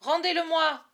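high, loud plea to give it back; desperate demand. Acted. Boy shouts at the falconer to return his hawk. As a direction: yell it at the captors.

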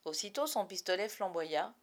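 plain reading voice

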